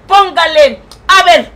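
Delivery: ordinary talk, nothing marked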